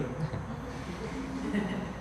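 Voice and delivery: quiet airy chuckle; little breathless giggle at the joke; soft breathy laugh. Real, not acted. Joke about the fridge.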